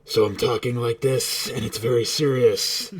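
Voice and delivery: muffled, as if inside a helmet; voice through a cup. Shown as a demonstration. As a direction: speak into a cup held to the mouth. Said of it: "cup held up to my face".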